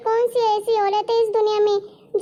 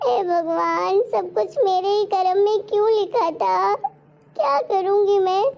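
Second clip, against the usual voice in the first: crying. Acted. Childless wife weeping over her fate, crying to God. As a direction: cry out to God through tears. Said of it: "तुम रोना बंद करो यार".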